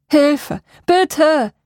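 high, loud pleading shout that sounds off; strange cry for help. A narrator's reading. Martin's voice calls from the forest, strangely pleading. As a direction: call for help, oddly unnatural. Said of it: "Seine Stimme klang in meinen Ohren seltsam".

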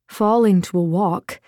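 ordinary delivery

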